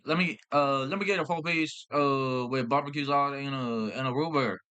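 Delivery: imitated backwoods country accent; mock a hard-to-decipher country customer; thick country accent. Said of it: "their accent sounds like they just came from".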